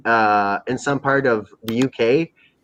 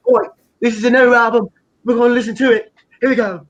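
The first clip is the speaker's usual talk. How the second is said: put-on higher, louder English-accented announcement; English MC hype; 9 semitones higher, 4 dB louder than usual. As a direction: announce it like an English pirate-radio MC. Acted MC announcing his new album.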